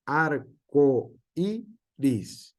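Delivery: Arco iris said very slowly, o and i kept apart; slow demo. Shown as a demonstration. Teacher sounding out an example for the class, slowly. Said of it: "listing hiatus example words".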